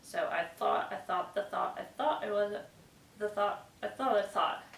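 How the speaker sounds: "thought" slowly with the tongue touching the finger for the th; every th in "thought" exaggerated, tongue between the teeth; slow careful th. Shown as a demonstration. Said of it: "every time you say the T H sound".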